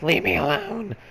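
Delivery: high childish protest, mock yell; lightly voiced little girl; cute little girl voice. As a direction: imitate a small girl telling you off. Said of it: "her voice was so cute".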